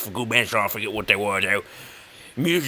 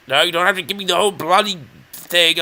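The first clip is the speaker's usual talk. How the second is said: exasperated; 5 semitones higher, 4 dB louder, faster than usual. Real, not acted. Impatient at the wiki text.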